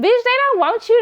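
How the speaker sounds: high, loud sassy call-out; yelling at the screen. Retold or imitated. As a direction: shout it at the TV like she can hear you. Yelling at TV character.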